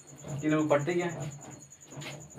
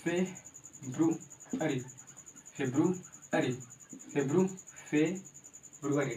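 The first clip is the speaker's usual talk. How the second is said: February stretched very slowly; slow pronunciation; much slower than usual. Shown as a demonstration. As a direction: drill February slowly for learners. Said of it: "फरवरी बता दिया मैंने कैसे". (February धीरे-धीरे दोहराना)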